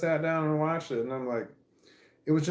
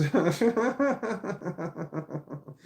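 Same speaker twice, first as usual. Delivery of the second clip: laughter; 4 semitones higher than usual. Real, not acted. Laughs at own story.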